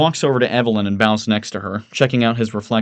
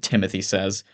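plain reading voice

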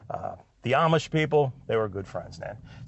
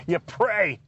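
laughing; 4 semitones higher than usual. Real, not acted. Punchline about praying, laughing.

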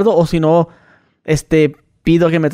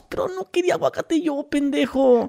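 higher, softer muttered insult; imitating her higher, softer complaint; mock angry wife; 8 semitones higher, 7 dB quieter than usual. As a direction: grumble back as the annoyed wife. Wife's angry retort voiced.

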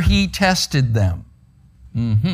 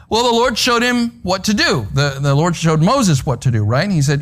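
usual speaking voice

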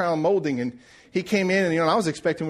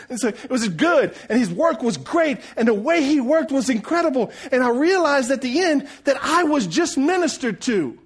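high, impassioned sermon climax; full-force preaching; 11 semitones higher, 4 dB louder than usual. Acted. Preacher telling church he was ministered to, full-force preaching. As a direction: build it up and proclaim it.

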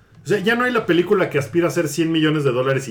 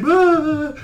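hammy stage actor; 11 semitones higher, 3 dB louder than usual. Retold or imitated. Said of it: "todo el libro se va así".

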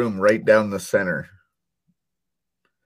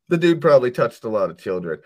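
ordinary talk, nothing marked

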